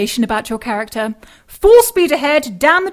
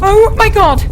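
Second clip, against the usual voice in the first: shocked gasp; 5 semitones higher, 5 dB louder than usual. Acted. Elizabeth sees Annie fall.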